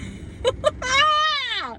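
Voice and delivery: long, high-pitched laughing wail; high squealing laugh. Real, not acted. Losing it over the universe's timing, high squealing laugh.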